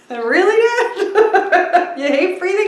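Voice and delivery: high, slow, laughing at dad; laughing disbelief. Retold or imitated. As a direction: tease him through a laugh.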